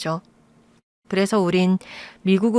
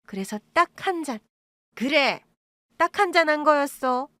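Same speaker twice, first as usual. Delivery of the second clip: higher, coaxing mother's voice; wheedling excuses; 6 semitones higher than usual. Acted. Birth mother making excuses to her sick daughter, higher and coaxing. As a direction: talk your way out of it, higher.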